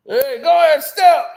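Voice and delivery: loud hype shout; hype call. Pretend. Hyping up the crowd, loud call. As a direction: call out the dance step.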